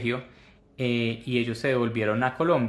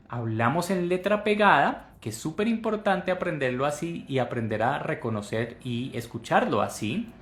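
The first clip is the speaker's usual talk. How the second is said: normal talking voice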